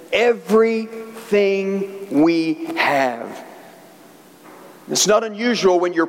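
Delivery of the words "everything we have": very slow, each word stretched out; slow emphatic delivery. Real, not acted. Preacher telling friends what commitment means, slow and emphatic. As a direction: spell it out word by word, weightily. Preacher driving the point home.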